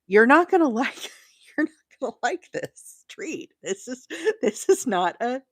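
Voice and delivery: laughing through the words. Real, not acted. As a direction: giggle while telling the dog off.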